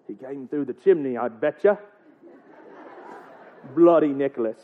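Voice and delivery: low grumbling Irish; mock Irish accent. Parent works out how Nicholas got in, grumbling mock Irish accent. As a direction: grumble suspiciously in put-on Irish. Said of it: "I'm gonna get that accent".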